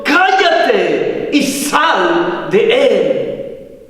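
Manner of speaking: very slow, commanding exorcism order; stern rebuke. Retold or imitated. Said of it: "reprende al espíritu inmundo".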